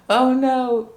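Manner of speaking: high, loud mock alarm; dismayed oh no. Real, not acted. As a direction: react like bad news. Reacting to European percentage.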